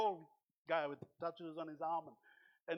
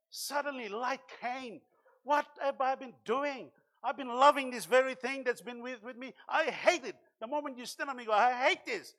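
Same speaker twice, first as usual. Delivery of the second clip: loud, rising sermon delivery; preaching at full force; 8 semitones higher, 9 dB louder than usual. Acted. Pastor preaching to the church about willpower, fervent and loud. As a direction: drive the message home to the congregation.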